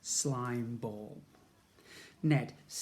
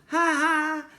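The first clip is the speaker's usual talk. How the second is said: loud high mocking laugh; chuckle; 13 semitones higher, 10 dB louder than usual. A narrator's reading. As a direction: laugh at her gleefully. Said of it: "Ned couldn't help but chuckle".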